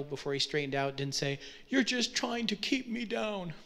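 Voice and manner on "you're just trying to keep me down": higher-pitched mock teenage protest; protesting teenage hero. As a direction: complain like a movie teenager to his parents. Acting a teenage movie hero confronting parents.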